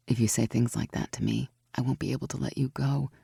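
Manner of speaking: low, soft, tender. A narrator's reading. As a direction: hold her close. Tender, softer.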